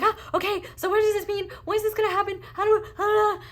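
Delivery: mock panic in a high, loud cartoon voice; panicking mind. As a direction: squeak out the mind's panic. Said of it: "the mind is, like, panicking".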